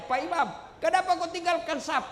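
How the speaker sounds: high-pitched indignant questioning; mock confrontation. Retold congregation confronts the imam.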